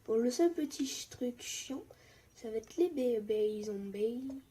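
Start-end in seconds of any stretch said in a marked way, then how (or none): none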